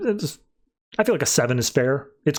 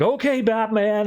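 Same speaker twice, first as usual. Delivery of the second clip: obedient reply; 7 semitones higher than usual. Retold or imitated. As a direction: play a mildly entranced yes-man.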